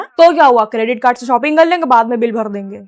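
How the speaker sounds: doting husband. Acted. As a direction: play the indulgent man of the house.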